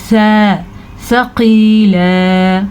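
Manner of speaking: सा with tongue-tip soft th, then सकीला drawn out; सकीला slowly, th not s; soft tha. Shown as a demonstration. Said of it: "नरमी से पढ़े जाने वाले".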